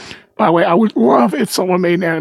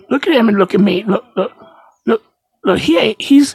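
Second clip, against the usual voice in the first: ordinary talk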